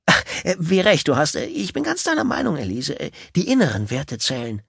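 high, flustered, stammering agreement; flustered eager man. Acted. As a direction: scramble to agree with her. Gail fumbles agreement.

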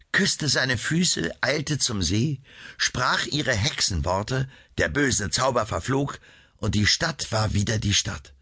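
ordinary delivery, nothing marked

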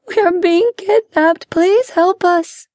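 put-on very high girlish plea; pleading young girl. A narrator's reading. Kidnapped younger sister begs strangers for help, high pleading. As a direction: beg for rescue in a high girl's voice. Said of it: "called the younger girl".

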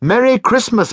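bright, high, loud holiday greeting; happy cry. Acted. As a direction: call out Merry Christmas with joy. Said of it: "cried a happy voice".

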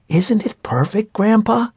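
put-on high, proud child voice; little girl voice. Emma proud of her tree.

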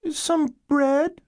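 put-on very high little-girl voice; young girl. Girl pleading with caseworkers at the door, young child voice. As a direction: pitch it way up like a small child. Hungry girl's voiced response.

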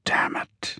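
whispered through clenched teeth; hushed, unvoiced curse; muttered. A narrator's reading. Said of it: "he muttered, teeth clenched".

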